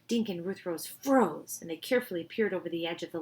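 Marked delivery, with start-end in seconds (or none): none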